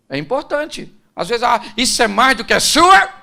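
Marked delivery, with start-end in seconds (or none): 1.4-3.1 loud, higher-pitched protest; indignant objection. Retold or imitated. Someone dismisses another's effort, indignant. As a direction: throw it back as a loud mock objection.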